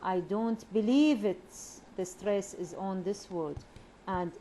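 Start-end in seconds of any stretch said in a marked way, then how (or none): 0.0-1.5 louder, with a big swooping rise-fall on "believe"; exaggerated moan. Shown as a demonstration. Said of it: "for rise and fall".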